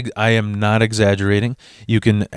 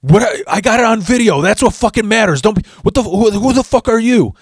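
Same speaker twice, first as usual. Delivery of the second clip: high, screeching angry mom voice; mock shrill yelling mother; 10 semitones higher than usual. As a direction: shriek it like a furious trashy mom. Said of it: "And she's like".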